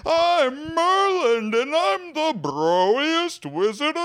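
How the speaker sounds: high, slow booming wizard; game show announcer. Acted. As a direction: boom it like a prize announcer. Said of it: "You sound like a game show announcer".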